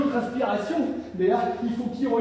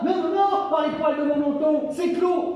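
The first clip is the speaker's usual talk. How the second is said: high squeaky pig voice; a higher, teasing little pig; little pig refusing; 5 semitones higher than usual. Acted. Piglet refuses the wolf, high and cheeky.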